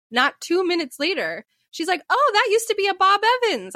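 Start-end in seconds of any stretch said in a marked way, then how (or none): 2.1-3.7 high, chirpy mom impression; mimicked sudden recognition. Daughter mimicking mom spotting an old landmark, chirpy recognition. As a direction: pipe up as if spotting a familiar place.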